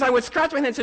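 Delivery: usual speaking voice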